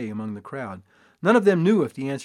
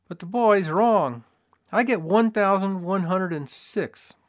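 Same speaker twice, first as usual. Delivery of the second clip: assayer's voice; 6 semitones higher, 5 dB louder than usual. A narrator's reading. Assayer corrects the boy.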